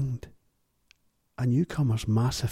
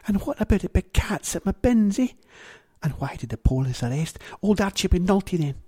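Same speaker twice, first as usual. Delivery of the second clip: higher, louder indignant woman; lightly voiced indignant Missus Galdini; 8 semitones higher, 3 dB louder than usual. Missus Galdini arguing with Gowser, higher indignant voice. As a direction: challenge him sharply.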